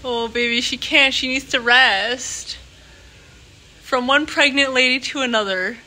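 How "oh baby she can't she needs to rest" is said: ordinary delivery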